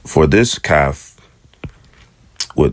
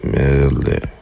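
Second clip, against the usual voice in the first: ordinary talk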